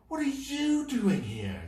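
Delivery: high loud surprised question; astonished to see him here. Acted. Horace surprised.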